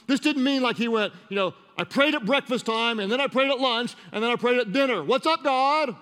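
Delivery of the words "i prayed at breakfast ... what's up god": higher, breezy list of daily prayers; mock casual prayer. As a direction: rattle it off like a glib churchgoer. Mocks a too casual prayer.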